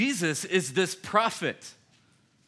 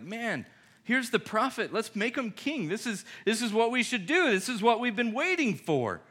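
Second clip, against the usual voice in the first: higher, louder eager rally cry; rally them to crown him; eager crowd; 7 semitones higher, 3 dB louder than usual. Retold or imitated. Crowd urging each other to make him king, eager. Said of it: "We're like".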